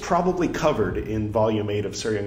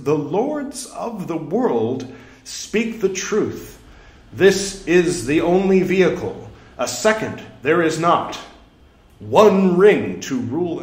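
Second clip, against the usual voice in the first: louder, drawn-out scriptural declamation; grand proclamation; 7 semitones higher, 7 dB louder, slower than usual. Acted. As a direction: proclaim it with heavy weight on key words. Declaims sutra, slips into quote.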